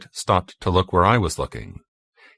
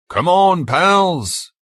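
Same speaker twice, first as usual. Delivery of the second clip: high, loud rallying call; yelling; 11 semitones higher, 5 dB louder, much slower than usual. A narrator's reading. Billy charging at the bridge to his pals, high rallying yell. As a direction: call out to urge the others along. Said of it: "we heard him yell".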